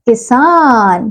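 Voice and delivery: slow, stretched-out word; drawn-out answer. Shown as a demonstration. Teacher reveals the answer word, slow and drawn out. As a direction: draw the word out for the children. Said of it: "ये शब्द क्या बन गया बच्चों?".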